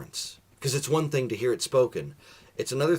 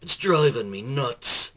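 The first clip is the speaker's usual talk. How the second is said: pirate voice; 8 dB louder than usual. Acted. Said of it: "The pirate said".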